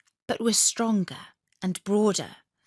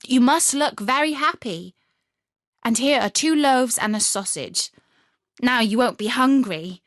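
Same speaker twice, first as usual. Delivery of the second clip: bossy cheer; 4 semitones higher, 6 dB louder than usual. Acted. Robber girl cheers Gerda.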